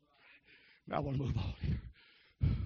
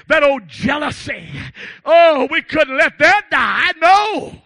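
raised, slow preacher's protest; louder, higher pulpit outburst; mock protest; 7 semitones higher, 14 dB louder, slower than usual. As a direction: cry out in exaggerated objection. Mock lament mid-sermon.

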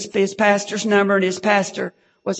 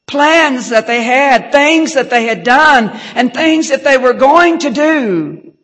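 higher and loud, slow on "plans"; fiery preaching; 5 semitones higher, 7 dB louder than usual. Acted. Pastor denouncing the cartel men, loud and high. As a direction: thunder it against the evil men.

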